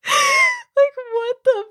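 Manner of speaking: very high, squeaky outburst of disbelief; stunned squeal. Real, not acted. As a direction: let it fly up into a shriek of disbelief. Own stunned reaction after laughing.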